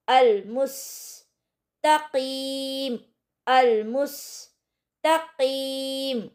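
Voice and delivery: अल मुस्तकीम very slowly with a heavy क in कीम; slow recitation. Shown as a demonstration. Teacher models the correct अल मुस्तकीम, slow tajweed. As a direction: draw out अल मुस्तकीम carefully. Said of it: "इस तरीके से अदा करेंगे".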